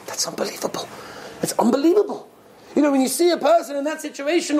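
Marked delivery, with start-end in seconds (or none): 0.1-0.9 hushed, voiceless amazement; soft whispered marvelling; awed whisper. Real, not acted. Awed reaction to the story. 1.4-2.3 higher-pitched, full-voiced wonder; voiced amazement. Real, not acted. Same reaction repeated aloud in amazement.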